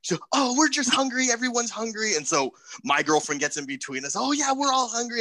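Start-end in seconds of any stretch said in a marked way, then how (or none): 0.3-2.2 high-pitched imitation of a peacemaker; placating girlfriend. Storyteller voices the other driver's girlfriend calming the quarrel, high and placating. 4.2-5.2 high-pitched imitation, eagerly agreeing; agreeable girlfriend. Storyteller voices his girlfriend stepping between them, high and agreeable.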